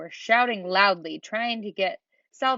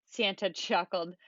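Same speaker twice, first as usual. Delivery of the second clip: plain reading voice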